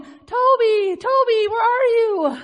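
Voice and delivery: high, loud call into the dark woods. Retold or imitated. Mother calling her lost dog Toby at night, loud and anxious. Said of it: "and I'm calling".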